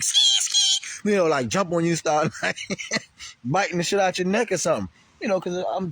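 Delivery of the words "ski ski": piercing high-pitched rat screech; imitated rat squeal. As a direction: squeal like an attacking movie rat. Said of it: "jump on you and be like".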